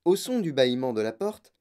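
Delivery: ordinary delivery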